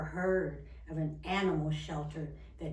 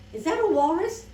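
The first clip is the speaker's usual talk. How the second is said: high, loud disbelieving question; astonished; 11 semitones higher, 13 dB louder than usual. Acted. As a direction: exclaim in storybook amazement. Reader reacts to the reveal.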